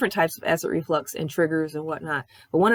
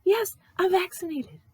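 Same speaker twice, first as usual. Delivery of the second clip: mock-shrill, much higher exasperated reply; exasperated retort; 8 semitones higher than usual. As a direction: squeak it out like you're fed up with the question. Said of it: "I'm like".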